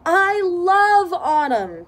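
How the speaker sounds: high, loud and slow; joyful declaration. Acted. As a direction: savour every word with delight. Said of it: "Pete says".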